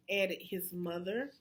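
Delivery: ordinary delivery, nothing marked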